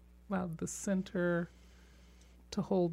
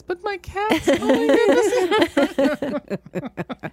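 gushing cat voice; 9 semitones higher, 11 dB louder, slower than usual. Retold or imitated. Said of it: "Back to my husband like this".

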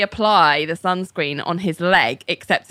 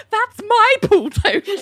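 a much higher, slow sulky voice; high, slow John voice; 3 dB louder, slower than usual. Retold or imitated. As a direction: whine it slowly like a grumpy friend. John's deadpan aside, voiced.

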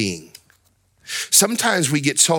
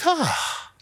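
mock calming-down sigh, pitched up; acted relief; 8 semitones higher than usual. Preacher acts someone who counted to ten, relieved. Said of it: "when you got to ten, you're like".

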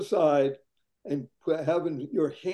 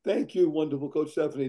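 normal talking voice

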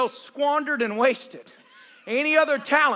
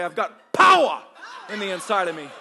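African-style emphasis; 8 semitones lower than usual. Shown as a demonstration. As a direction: say POWER like an African preacher.

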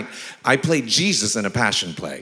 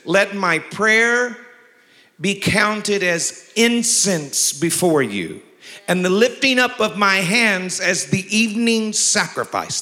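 slow, solemn pulpit reading; preacher intoning the verse; scripture reading; 7 semitones higher than usual. Acted. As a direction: read it with weight, drawing out the words.

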